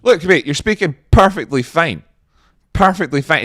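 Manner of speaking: heated, supportive protest; emphatic reassurance. Pretend. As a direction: insist loudly to a mate. Insists to man in the video.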